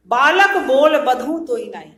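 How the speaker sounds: loud declaimed verse; verse recitation. Shown as a demonstration. Teacher quoting Parashuram's verse to students, loud recitation. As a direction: recite it loudly for the class.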